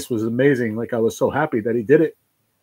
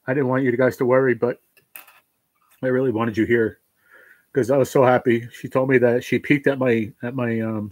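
ordinary talk

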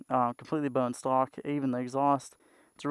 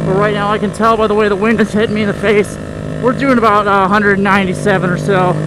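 loud, raised voice over roaring wind; shouting over wind; 6 semitones higher, 13 dB louder than usual. Real, not acted. Rider shouting to camera over wind noise, loud real shout. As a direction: yell to be heard on the moving bike.

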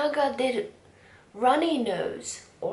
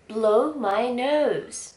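ordinary talk

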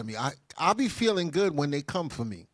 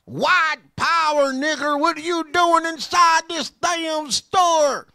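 high, loud, slow sneering yell; 9 semitones higher, 9 dB louder, slower than usual. Retold or imitated. Imitating store locals glaring at him, slow loud sneering yell. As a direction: spit it out like a hostile bigot. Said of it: "the spirit I got from them".

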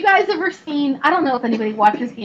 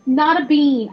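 normal talking voice

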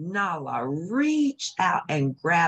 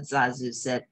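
ordinary delivery

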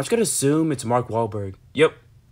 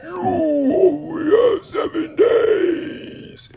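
very high, very slow warped shout; drawn-out haunted scream; demonic voice; 4 dB louder, much slower than usual. Pretend. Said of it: "my demonic voice".

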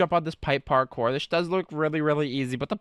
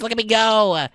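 ordinary delivery

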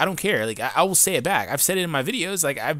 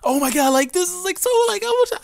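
over-the-top mock excitement, pitched way up; fake hyped; 5 dB louder than usual. YouTuber mocking fake creators who act super hyped, fake hyped. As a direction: squeal it in a high, loud fake gush. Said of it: "I don't get fake".

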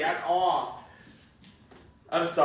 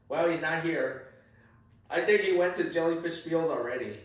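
normal talking voice

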